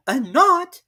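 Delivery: high, loud eager question; surprised. Acted. Alice mishearing, high and eager. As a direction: perk up helpfully.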